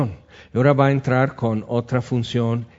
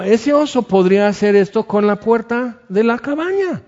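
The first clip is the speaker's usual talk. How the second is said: higher, louder alarmed voice; a touch of retold fear; alarmed realisation, lightly played; mock alarm; 9 semitones higher, 4 dB louder than usual. Retold fearful thought.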